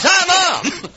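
high-pitched mock greeting; mocking imitation. Preacher complaining about players greeting only mothers, mocking imitation. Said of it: "I know it's always".

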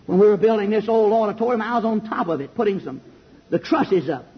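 ordinary talk

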